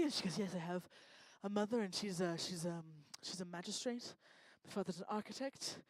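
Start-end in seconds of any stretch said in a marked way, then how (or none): 0.4-4.1 soft, low-pitched posh imitation; mock a grand sibling's hesitant small talk; understated, hushed posh put-on voice; posh sister. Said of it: "she's exceedingly posh". 4.6-5.8 mock a grand sibling's hesitant small talk; understated, hushed posh put-on voice; posh sister. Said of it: "she's exceedingly posh".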